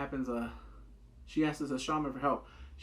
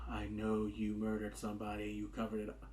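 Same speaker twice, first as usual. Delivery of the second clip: menacing; 6 semitones lower, 8 dB quieter than usual. Retold or imitated. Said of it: "Talking about".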